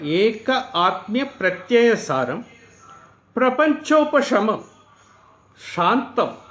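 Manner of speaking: slow, measured chant of the Sanskrit verse; a teacher intoning scripture word by word; mantra recitation. Acted. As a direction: recite each word with a pause.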